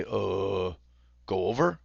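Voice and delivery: drawn-out "uh", then questioning; unsure answer. Acted. Acted unsure player's answer.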